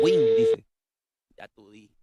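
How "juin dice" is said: much higher squeak; squeaky mock voice. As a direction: squeak the name. Imita voz aguda burlona. (voz chillona)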